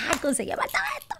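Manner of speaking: high, airy squeal of joy; happy exclamation. Retold or imitated. New tenant rejoicing at getting her apartment, retold happy squeal. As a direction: imitate a soft, giddy little squeal. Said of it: "yo estaba feliz, yo decía".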